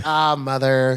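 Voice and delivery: sons chorus. Retold or imitated. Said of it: "They were like".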